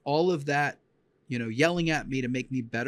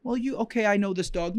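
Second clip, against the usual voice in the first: higher, pleading student voice; lightly voiced student defending herself; a student insisting she knows the dog; mock insistent student; 7 semitones higher than usual.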